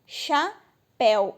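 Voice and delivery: "Chapéu" split slowly into cha and péu; slow teacher's syllable split of "Chapéu"; two syllables. Shown as a demonstration. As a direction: say "Chapéu" in two beats, "éu" unbroken. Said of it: "ele não separa".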